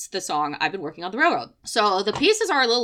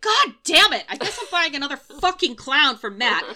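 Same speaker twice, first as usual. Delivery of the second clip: higher, louder mock exasperation; grudging give-in, buying it anyway; exasperated groan; 6 semitones higher, 5 dB louder than usual. Resigned to buying another clown for her friend, exasperated. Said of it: "I'm like".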